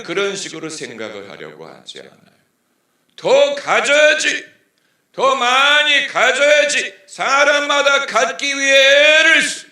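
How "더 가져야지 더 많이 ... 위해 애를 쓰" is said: booming high sermon shout, slow at first; full-volume preacher's call; preaching at full force. Acted. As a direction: cry it out from the pulpit. Preacher shouts greedy thoughts.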